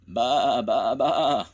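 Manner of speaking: loud high happy bleats; pleased sheep. A narrator's reading. Sheep pleased to ride along, loud cheerful bleating. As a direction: bleat with delight. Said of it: "said the sheep, very pleased".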